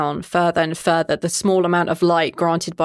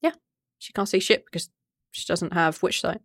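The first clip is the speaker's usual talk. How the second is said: ordinary talk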